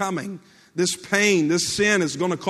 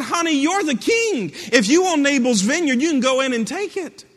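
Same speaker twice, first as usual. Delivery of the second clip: coaxing wicked queen; 6 semitones higher, 4 dB louder than usual. Retold or imitated.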